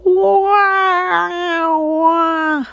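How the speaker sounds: high wailing like a cat left out on the porch; cat-like whinny. A narrator's reading. Boy trying to neigh for his friends, comes out as a cat wail. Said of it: "like a cat left out on the porch".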